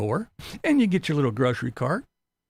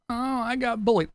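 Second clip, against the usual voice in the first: mocking whiny voice; 9 semitones higher than usual.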